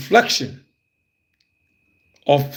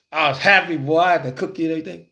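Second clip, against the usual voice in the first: ordinary talk, nothing marked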